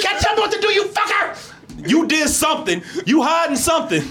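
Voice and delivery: put-on very high shrill teen tantrum; teen girl scream imitation. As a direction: scream it like a girl mad at her mom.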